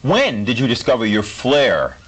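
usual speaking voice

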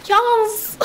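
high exclaimed catchphrase; YouTuber impression. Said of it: "impersonate some of my favorite YouTubers".

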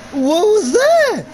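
ordinary delivery

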